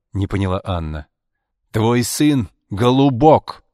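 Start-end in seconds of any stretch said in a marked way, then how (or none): 1.7-3.6 loud, high, drawn-out shout; scornful shout. A narrator's reading. Arkady exposes his son to Anna, shouting. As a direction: shout each word out slowly. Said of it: "крикнул Аркадий".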